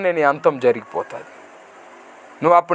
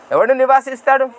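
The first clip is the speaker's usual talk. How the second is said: usual speaking voice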